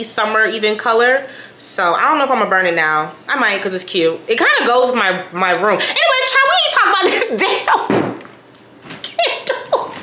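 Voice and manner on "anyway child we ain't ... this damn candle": high laughing scold of herself; giggly high aside. Real, not acted. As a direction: laugh through it, pitched up. Laughing self-interruption.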